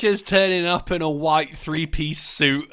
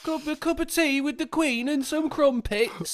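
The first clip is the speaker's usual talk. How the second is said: high-pitched caricature of English speech; silly put-on English voice, pitched up; mock English accent; 6 semitones higher than usual. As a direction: ham up the English tea-drinker. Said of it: "made fun of an English person for their accent".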